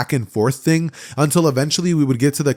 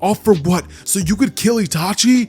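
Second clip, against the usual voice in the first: higher-pitched, insistent argument; acted impassioned confrontation; 7 semitones higher than usual. Naruto confronts Sasuke for leaving, acted impassioned plea. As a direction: press each question on a runaway friend.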